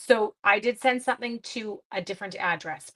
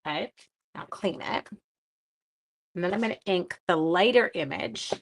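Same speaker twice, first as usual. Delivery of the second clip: ordinary talk, nothing marked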